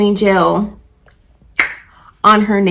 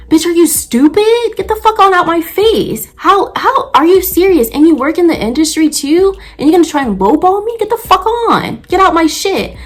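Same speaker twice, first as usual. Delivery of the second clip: high-pitched indignant rant; irritated outburst; 7 semitones higher than usual. Acted. Nail tech to a lowballing client, irritated high-pitched outburst. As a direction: tell them off in a high, incredulous voice. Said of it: "That's how I'm feeling. I was really irritated".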